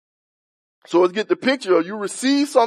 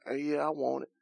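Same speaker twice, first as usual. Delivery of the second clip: half-hearted; 6 semitones lower, 16 dB quieter than usual. Retold or imitated. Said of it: "you be like".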